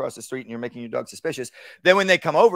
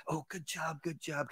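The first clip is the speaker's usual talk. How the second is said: gushing praise, wrong way; 2 semitones higher, 15 dB quieter than usual. Shown as a demonstration. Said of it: "what I don't wanna do is this".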